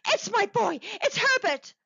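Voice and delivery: high, loud, desperate mother; frantic cry. A narrator's reading. Mother struggling toward her son at the door, frantic cry. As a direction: cry out while struggling to get free. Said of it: "she cried, struggling mechanically".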